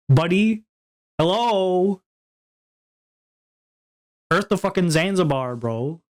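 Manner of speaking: a hammed-up annoyed tirade; mock outrage. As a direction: act fed up with the useless advice. Mock rant at chat advice.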